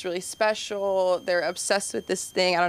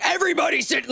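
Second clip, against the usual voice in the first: high-pitched mock panic; hammed-up alarm, pitched up; freaking out; 8 semitones higher, faster than usual. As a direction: re-enact a panicked order to the kids. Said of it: "freaking out".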